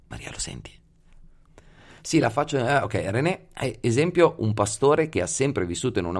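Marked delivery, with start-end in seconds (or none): 0.1-0.7 soft whispered question; whispering. Real, not acted. Whispered aside to a listener.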